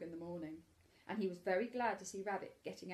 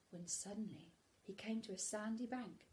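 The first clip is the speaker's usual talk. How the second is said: whisper; 8 dB quieter than usual. A narrator's reading. Suspense in story.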